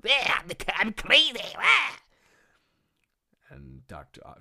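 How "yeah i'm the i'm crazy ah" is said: high, loud cartoon maniac; screechy mock madman, rising to a yell; Joker parody. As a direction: go completely over-the-top crazy. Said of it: "like he's like the Joker".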